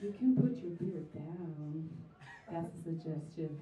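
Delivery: slow, low and soft; calm suggestion. Retold or imitated. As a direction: gently suggest it. Calm advice to drunk guys.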